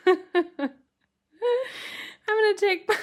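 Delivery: laughing. Real, not acted. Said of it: "I've got to stop laughing".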